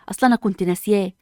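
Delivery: ordinary talk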